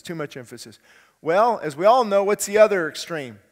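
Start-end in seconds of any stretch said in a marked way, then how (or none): 0.0-0.8 quiet echo. Shown as a demonstration.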